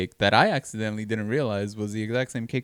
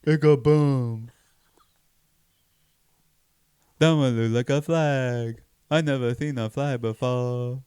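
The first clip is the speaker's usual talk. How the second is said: put-on slow, slurred, dopey drunk; 4 semitones higher, 4 dB louder, much slower than usual. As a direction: play a wide-eyed drunk watching fireworks. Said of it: "That's my drunk voice".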